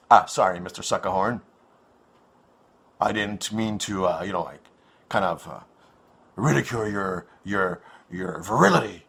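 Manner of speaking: low, soft, mock-sheepish apology. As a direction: pretend to apologise meekly. Mock apology to older guest.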